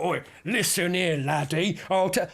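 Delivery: lightly imitated Scottish boss telling him off; a mock burly Scottish fella scolding; Scottish CEO. The Scottish CEO tells the young worker off, mild Scottish imitation. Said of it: "he's a big Scottish burly fella".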